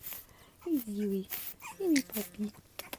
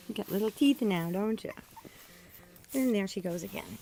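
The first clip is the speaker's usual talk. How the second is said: ordinary talk, nothing marked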